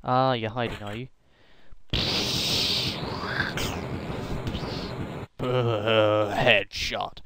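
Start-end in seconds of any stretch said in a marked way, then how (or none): 1.6-5.3 sly chuckle. Real, not acted. Evil chuckle at hider.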